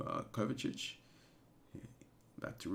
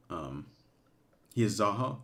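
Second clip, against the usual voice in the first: ordinary talk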